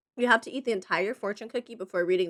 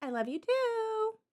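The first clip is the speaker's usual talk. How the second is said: tender; 13 semitones higher, 5 dB quieter, slower than usual. Real, not acted. Reply to loved one, cooing.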